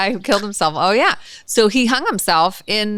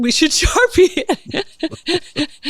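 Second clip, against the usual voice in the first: laughing; 9 semitones higher, much slower than usual. Real, not acted. Laughing at an inside joke.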